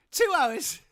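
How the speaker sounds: bigger, shriller-pitched repeat of the tease; exaggerated mock yell. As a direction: squeal it back at the latecomer.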